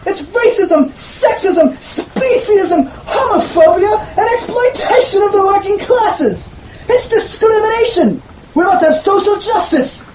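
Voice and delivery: a professor ranting about social justice; exclaiming professor. Acted. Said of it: "he exclaimed".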